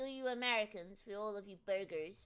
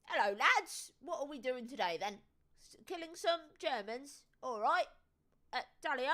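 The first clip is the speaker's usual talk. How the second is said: Captain Price impression; 3 semitones higher, 3 dB louder than usual. Streamer imitating his favourite British soldier, light army captain voice. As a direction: greet the lads like a gruff British captain. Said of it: "There he is, Captain Price".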